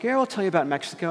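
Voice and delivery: imitated excitement, tumbling out fast; all excited. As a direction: rush it out like an eager guest. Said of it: "they got all excited".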